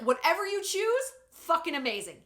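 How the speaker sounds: high, loud, emphatic cheering-on; gushing cheerleader support. Acted. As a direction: gush with total, unquestioning support.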